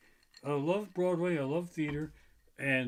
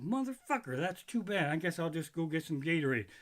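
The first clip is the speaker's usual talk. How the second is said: usual speaking voice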